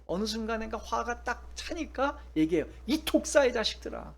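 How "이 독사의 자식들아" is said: a touch of Jesus rebuking, raised voice; Jesus scolding the religious leaders, voiced with some heat; mock angry rebuke. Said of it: "화를 내시는 거예요".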